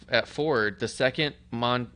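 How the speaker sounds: ordinary talk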